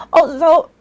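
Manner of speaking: a catch in the throat, voice breaking; voice cracking. Real, not acted. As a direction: voice cracks on a high rise. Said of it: "my, my voice".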